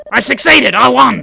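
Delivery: high, loud victory cry; triumphant cheer. Acted. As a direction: celebrate a win at full voice. Player mock-celebrates winning the game.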